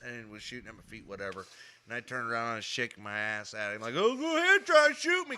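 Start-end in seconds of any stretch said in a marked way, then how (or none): 4.0-5.4 high, loud teasing dare; mock taunt. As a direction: dare him, smug and singsong. Taunting brother, shaking butt.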